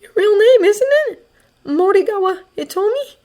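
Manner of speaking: plain reading voice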